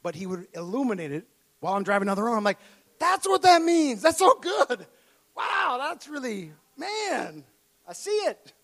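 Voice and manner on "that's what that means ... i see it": high, loud, slowed-down delight at a discovery; excited realization. Retold or imitated. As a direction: gush like the meaning just hit you. Retold delight at understanding scripture.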